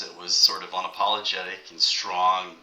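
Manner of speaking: usual speaking voice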